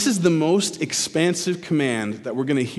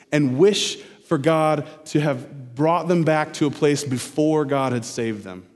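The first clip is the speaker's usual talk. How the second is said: normal talking voice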